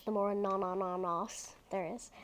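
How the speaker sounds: ordinary delivery, nothing marked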